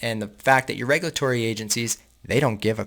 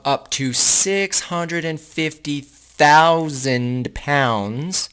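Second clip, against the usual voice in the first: "six hundred and fifty thousand pounds" drawn out slowly and higher; incredulous emphasis; 4 semitones higher, 4 dB louder, much slower than usual. Real, not acted. Reader telling viewers an outrageous quantity, incredulous slow emphasis. Reader stressing a shocking figure.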